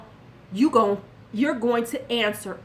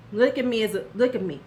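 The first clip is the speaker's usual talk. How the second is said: ordinary talk, nothing marked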